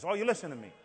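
ordinary talk, nothing marked

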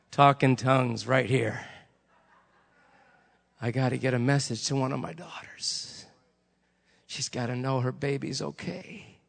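low, measured voice of God; God's calm reply. Retold or imitated. God needing to reach a daughter, calm and low.

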